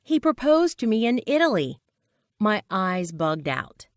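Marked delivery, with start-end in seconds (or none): 0.0-1.8 higher, gleeful voice without actual laughter; happy laughing announcement. A narrator's reading. Friend announces engagement to boyfriend, mildly gleeful. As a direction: share the engagement with delight. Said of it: "she laughed".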